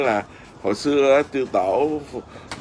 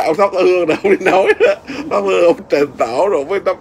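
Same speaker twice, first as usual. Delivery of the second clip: laughing; 5 semitones higher than usual. Real, not acted. Laughs while telling the ritual.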